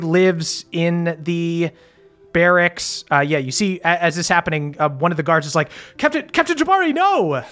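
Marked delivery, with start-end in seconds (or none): 6.0-7.4 high, pleading guard's cry; distressed cry. Acted. Guard cries out in dismay.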